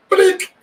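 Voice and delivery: high, loud blip; imitated button sound. Retelling the kick button being hit, high mimicked blip. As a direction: fire off a sudden high sound effect.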